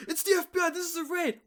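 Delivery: high, loud FBI bust announcement; hammed-up police raid yell; mock raid shout. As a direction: burst in shouting like a raiding agent. Acts out FBI door-kick raid.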